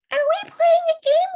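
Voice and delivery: put-on high, loud, childlike hen voice; Winnie's voice. Hen asking the fox, loud and high. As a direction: chirp it out brightly like a naive little hen. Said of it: "said Winnie".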